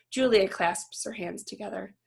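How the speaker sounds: plain reading voice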